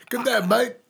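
put-on high, laughing Australian accent; hammy Aussie greeting through laughter; fake Australian accent. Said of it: "I'll fake a Australian accent".

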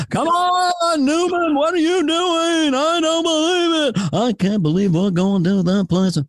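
very high, drawn-out exasperated comic; Jerry Seinfeld. Acted. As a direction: whine it in a sing-song rhythm. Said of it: "It's a good rhythm".

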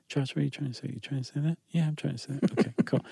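soft muttering to himself; hushed shoulder devil and angel; quiet inner voices. Pretend. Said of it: "at the devil and the angel".

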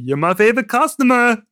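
mock Austrian salesman; Arnold impression. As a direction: gush down the phone in an Austrian accent. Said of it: "I love at the beginning when he's".

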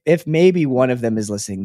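normal talking voice

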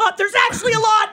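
high, fast, loud comeback; sarcastic shout. Retold or imitated. Wife retorting to the stranger, loud, high and fast. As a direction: snap back with mock sweetness.

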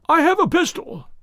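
high, loud, trembling threat; a scared man threatening from the dark; frightened man calling from the dark stairs, high and quivering; reedy quivering voice. Acted. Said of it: "a reedy, quivering voice".

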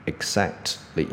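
drawn-out "exactly" with an audible t before ly; student mispronunciation. Retold or imitated. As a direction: split "exactly" into exact and ly, slowly. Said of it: "学生会念成". (模仿学生拖慢念"exactly"，t念得清楚)

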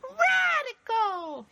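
very high, stretched-out squealing cheer; imitated kid's shout. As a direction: holler it like an awestruck movie kid. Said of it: "and the kid goes".

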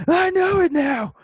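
high shout of realization; sudden shout. Pretend. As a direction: yell it bolt upright. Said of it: "and be like".

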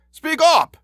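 loud, very high-pitched shout; shouted order. A narrator's reading. Inspector to the dazed constable, shouting. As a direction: shout it in exasperation. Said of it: "shouted the inspector".

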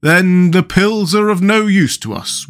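high, slow, drawn-out wail. A narrator's reading. Pumpkinhead learns the pills are useless, wailing. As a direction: wail it like a woeful storybook figure. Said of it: "wailed the Pumpkinhead".

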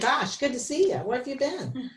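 high-pitched, hammed-up chatty greeting; mock small talk. Co-host spoofing small talk in dialogue, high mock-friendly gush. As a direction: gush in mock delight at meeting someone.

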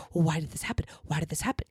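breathy whisper, questions rising; whispered brain voice. Retold or imitated. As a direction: whisper it as your anxious inner voice. Voicing the brain's inner question.

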